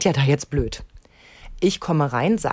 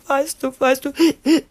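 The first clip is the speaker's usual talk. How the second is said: put-on crying kid; 9 semitones higher, 5 dB louder, slower than usual. Weinendes Kind.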